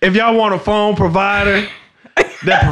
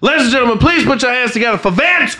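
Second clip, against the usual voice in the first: announcer; 4 semitones higher than usual. Pretend. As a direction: introduce him like a ring announcer. Announcing the guest entrance.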